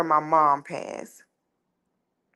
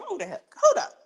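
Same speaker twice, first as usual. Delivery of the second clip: higher, alarmed outburst; startled; 12 semitones higher, faster than usual. Retold or imitated. Narrator startled after seeing her uncle's shadow. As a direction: jolt back as if something just moved.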